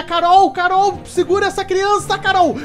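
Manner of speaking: high, loud calling out; panicked parent yelling for help; frantic yell. Acted. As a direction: shout at her to grab the child. Yelling for wife to grab kid.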